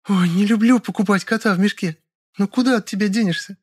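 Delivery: high female character voice; high-pitched, affected resignation; Lyolya, playfully reluctant. Acted. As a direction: give in coyly in a high voice.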